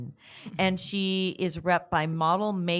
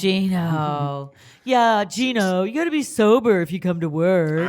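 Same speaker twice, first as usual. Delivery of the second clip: mock strict boss; 6 dB louder than usual. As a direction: play a stern boss scolding Gino. Mocking a strict boss figure.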